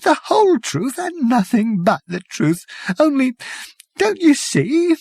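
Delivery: tremble with horror; shivering dread. Acted. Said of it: "he paused and shivered".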